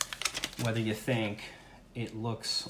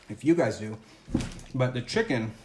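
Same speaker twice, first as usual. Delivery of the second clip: mouth full. Real, not acted. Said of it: "sorry for talking with my, uh, mouth open".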